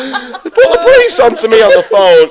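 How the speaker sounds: real laughter pushing the voice loud and high; laughing. Real, not acted. Telling mates a police story, loud and laughing. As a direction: fight through the laughs to carry on.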